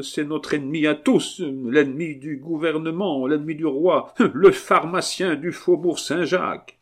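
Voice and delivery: high, indignant noblewoman; accusing marquise. Acted. Marquise naming the culprit, high and indignant. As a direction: denounce the enemy with rising force.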